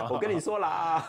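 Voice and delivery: put-on high, slow Malaysian Chinese accent through a laugh; laughing Malaysian-accented imitation; mock Malaysian Mandarin said with a giggle. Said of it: "就开始会有那个马来腔".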